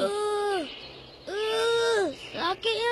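high, pained groan. Acted. Boy's foot caught in snare, wailing in pain. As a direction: strain and wail against the pain.

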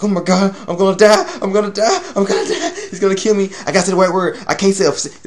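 high, frantic I'm gonna die; high-pitched frightened babble, a laugh breaking through; terrified panic. Retold or imitated. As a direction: panic as if about to be eaten. Scared character facing the king.

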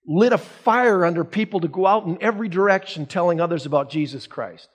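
ordinary talk, nothing marked

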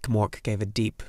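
ordinary delivery

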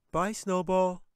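put-on young girl voice; Katie's voice. A narrator's reading. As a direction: play the little girl, scoffing. Katie waves goodbye.

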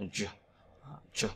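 "chờ" as a voiceless ch sound; CH without tongue vibration. Shown as a demonstration. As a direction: release "chờ" with air, tongue not buzzing. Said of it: "không rung lưỡi cho âm này". (đọc "chờ" không rung lưỡi)

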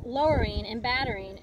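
usual speaking voice

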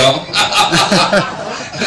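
risa aguda a golpes; reírse de su propia pulla; carcajada. Real, not acted. Se ríe de la edad ajena. (risotada aguda y entrecortada)

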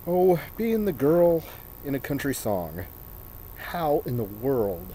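normal talking voice

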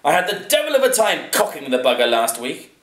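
loud, high aristocratic grumble; exasperated master of the manor; complaining lord. A narrator's reading. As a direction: complain emphatically to the servant.

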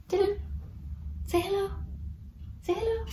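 very high soft sing-song; teaching a parrot. Real, not acted. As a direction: coo it up high to the bird. Coaxing parrot to say hello.

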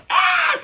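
very high scared shriek; startled scream. Retold or imitated. Comedian imitating his own frightened scream. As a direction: shriek like you got a fright. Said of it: "ビビった時の".

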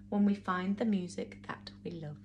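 soft unvoiced whisper. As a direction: breathe the moral softly. Soft ending line.

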